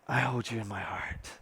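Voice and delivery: low, soft romantic croon, hammed-up; mock swooning. Showing single ladies how to swoon a boyfriend, mock romantic. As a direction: pretend to woo a sweetheart, very softly. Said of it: "you can swoon your potential boyfriend".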